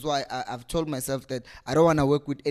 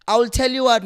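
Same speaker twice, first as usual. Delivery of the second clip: higher, louder challenge; heated; 7 semitones higher, 6 dB louder, faster than usual. Real, not acted. Fan calling out the chairman, heated. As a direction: square up to him.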